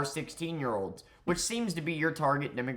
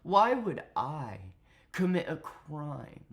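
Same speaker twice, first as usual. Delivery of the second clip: mocking impression of a smug smooth talker; mock seductive; 5 semitones higher than usual. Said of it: "proceeded to talk like this".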